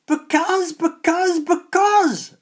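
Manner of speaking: furious high screaming hag; angry scream. A narrator's reading. Old hags screaming angrily at the questioning boy. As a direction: scream it at him, angrier each time. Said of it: "screamed the old hags, even more angrily".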